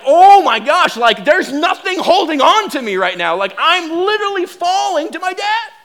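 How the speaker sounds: very high, loud cry of terror; panicked yelling. Retold or imitated. Rider in free fall feeling nothing holding him, panicked yelling. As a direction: yell as if the floor just vanished.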